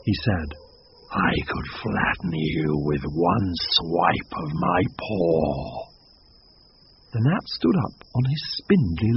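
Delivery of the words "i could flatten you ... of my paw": much lower, slow contemptuous growl; a big cat sneering at a bug; low, slow lion voice; scornful lion. Acted. Lion threatening the gnat.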